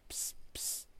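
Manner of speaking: soft whispered hiss; secretive psst. Acted. Robin Bird gets Joey's attention, whispering psst. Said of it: "Robin Bird goes".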